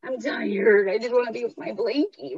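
childish tired whine, lightly hammed up; whiny me voice. Inner voice resisting the workout, mock childish whine. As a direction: whine like a sleepy child wanting comfort. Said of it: "me voice is like".

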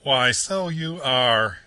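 loud, high, very slow exclaim; cried out. A narrator's reading. Barge woman discovering Toad, loud triumphant cry. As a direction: crow with disgusted discovery. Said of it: "she cried".

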